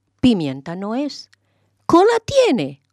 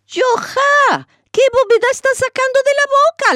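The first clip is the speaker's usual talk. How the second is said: much higher, fast, outraged neighbour; indignant high-pitched scolding; 4 dB louder than usual. A narrator's reading. Neighbour outraged at Yohá's claim that the pot died, high indignant scolding. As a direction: shriek at him in disbelief.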